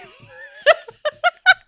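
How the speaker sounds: high squealing burst of laughter; shrieking laugh. Real, not acted. Host laughing at her own singing.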